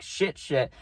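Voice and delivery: ordinary talk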